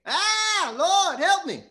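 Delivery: high, loud panicked cry for help; acted scream. Preacher acting Peter sinking in the water, high loud scream. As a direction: shriek it out in mock terror. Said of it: "he began to scream".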